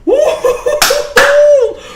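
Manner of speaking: high, laughing woo-hoo; excited whoop. Real, not acted. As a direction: whoop like a frat bro. Celebrating pick.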